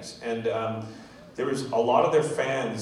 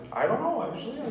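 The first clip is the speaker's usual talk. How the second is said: mock innocent; 12 semitones higher, faster than usual. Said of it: "And I was like".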